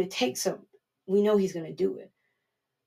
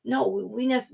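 normal talking voice

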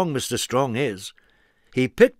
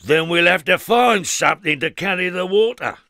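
higher, louder decisive voice; determined Mister Strong; 6 semitones higher, 5 dB louder than usual. Acted. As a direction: resolve it cheerfully. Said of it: "replied Mister Strong".

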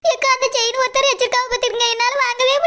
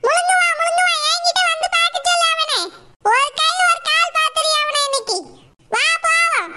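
bossy fish-seller woman; 5 semitones higher than usual. Acted.

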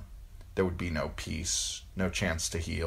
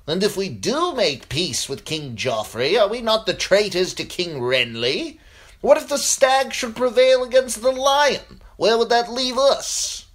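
high, loud challenging questions; agitated lord in heated debate; objecting lord; 9 dB louder than usual. Acted. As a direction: argue it hard, climbing higher with each question. Lord Blackwood argues in story.

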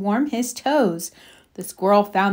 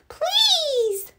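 very high, tiny plea; begging little mouse. Acted. Little mouse begs the animals, tiny pleading voice. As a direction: plead in a teeny mouse squeak. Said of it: "begged the little mouse".

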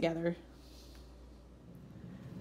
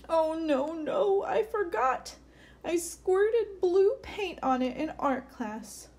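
mock dismay; 7 semitones higher, slower than usual. Swirly remembers the paint.

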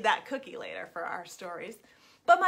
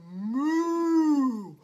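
loud, drawn-out mooing voice; cow voice; 5 dB louder, much slower than usual. Acted. Hungry cow calling after the gingerbread man, mooing. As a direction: moo the words like a big cow. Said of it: "the cow said".

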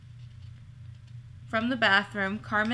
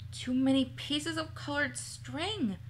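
softer, higher grumbled aside; muttering; 4 semitones higher, 5 dB quieter than usual. A narrator's reading. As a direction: mutter a complaint to a companion. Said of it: "Carmen muttered to Waif".

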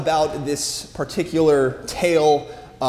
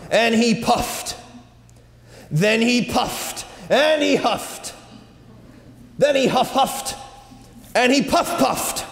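straining effort; 7 semitones higher than usual. A narrator's reading. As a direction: puff harder and harder. Wolf straining to blow house.

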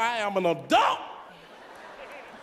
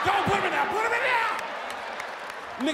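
much higher, urgent please-stop; frantic high plea; 10 semitones higher, faster than usual. Retold or imitated. Customer urgently stops cashier bagging donuts. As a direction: beg the cashier in a rush.

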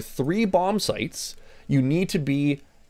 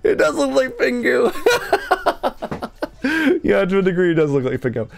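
laughing; 13 semitones higher, 4 dB louder than usual. Real, not acted. Laughing at Pingu joke.

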